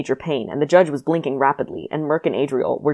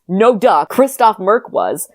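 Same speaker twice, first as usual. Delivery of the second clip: loud, slow, emphatic; all caps; 5 semitones higher, 5 dB louder, slower than usual. Shown as a demonstration. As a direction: spell it out in caps. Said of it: "All caps".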